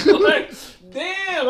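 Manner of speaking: laughing through the words; high laughing disbelief. Real, not acted. Laughing reaction.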